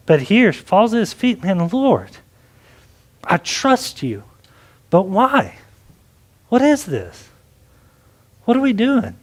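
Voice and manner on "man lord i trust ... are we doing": pausing, bewildered plea to the Lord, pitch climbing; trusting but disappointed. Retold or imitated. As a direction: mildly voice a let-down believer questioning God. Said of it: "faith and disappointment at one time".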